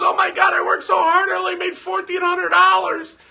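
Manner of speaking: high, loud mock wail, hammed-up crying; exaggerated imitation of wife crying; imitating bawling. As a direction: play the sobbing wife over the top. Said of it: "she was bawling".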